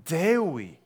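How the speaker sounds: full-force sermon voice; fiery preaching. Acted. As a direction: thunder the accusation at the congregation. Preacher thundering at congregation.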